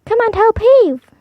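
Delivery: high, loud call to heave; calling for help. Acted. Cat calling the mouse to help pull. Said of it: "called the cat to a mouse".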